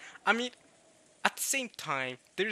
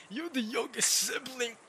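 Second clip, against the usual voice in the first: high, drawn-out mock disbelief; mocking older sibling; 8 semitones higher, slower than usual. Older sibling teasing the youngest, high mocking voice. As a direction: tease the kid in a high, slow voice.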